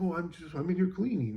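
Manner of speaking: imitating a caught slacker's excuse; mock coworker excuse. Mimicking the coworker caught hiding, a light mock voice. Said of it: "And he's like".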